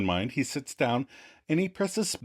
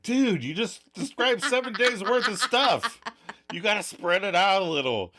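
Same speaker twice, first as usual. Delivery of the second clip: imitating Adam; 9 semitones higher than usual. Adam voiced in retelling.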